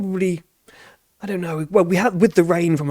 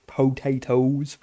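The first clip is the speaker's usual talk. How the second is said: drawn out; 4 dB quieter, much slower than usual. Shown as a demonstration.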